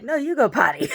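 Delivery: high, loud mock retort; dog talking back. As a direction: voice the dog's refusal, high and loud. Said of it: "she's like".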